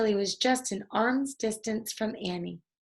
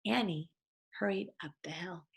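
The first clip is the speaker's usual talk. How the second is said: plain reading voice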